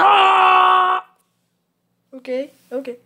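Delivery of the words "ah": loud, high held scream. Real, not acted. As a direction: scream out at losing in the game. Scream after a game failure.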